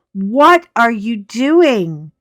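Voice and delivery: slow, loud, incredulous what are you doing; exasperated question. Retold or imitated. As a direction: stretch every word in disbelief. Quoting herself again, exasperated.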